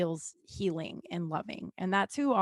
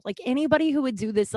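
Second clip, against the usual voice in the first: ordinary talk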